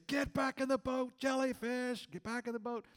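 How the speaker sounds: put-on high frantic yell to the kids; screaming. Dad in the water yelling at the teens to get back. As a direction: shout them back to the boat in a panic. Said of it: "I'm screaming to the kids".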